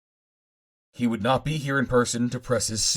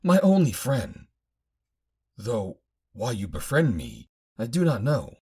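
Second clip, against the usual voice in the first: a hint of a higher, gentle woman's voice; lightly voiced woman; 4 semitones higher than usual. Captive woman confiding in her only friend, lightly voiced. Janai speaks to narrator.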